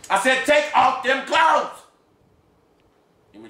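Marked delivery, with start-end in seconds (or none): 0.1-1.8 high, loud, slow shouted order; furious shout. Acted. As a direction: bark the command.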